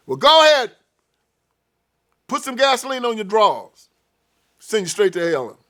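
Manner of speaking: high, loud, sarcastic verdict; mocking judge. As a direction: taunt the sinner with a sneer. God mocking sinner sending to hell.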